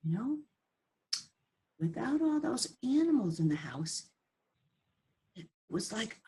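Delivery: hushed relief; whisper. Acted. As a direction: whisper it as the house goes still. Hushed after the chaos.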